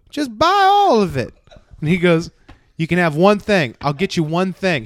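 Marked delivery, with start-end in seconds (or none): 0.1-1.3 very high, loud, slow shopper voice; high accented mock insistence; imitating demanding woman. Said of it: "And she goes". 2.8-4.8 loud, higher retold offer; emphatic acted compromise; mock exasperated bargaining. Retold man bargaining with woman.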